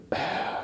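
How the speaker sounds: soft acted sigh; exasperated sigh, imitated; retold sigh. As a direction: let out a quiet, breathy sigh. Retold character sighs before replying.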